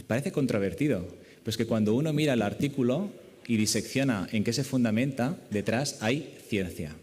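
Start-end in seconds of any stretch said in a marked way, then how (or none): none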